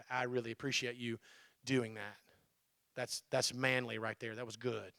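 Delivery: low, soft, caring words; tender close friend. Retold or imitated. Close friend hugging pastor after a hard sermon, soft and tender. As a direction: comfort him gently, close up. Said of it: "hugs me and goes".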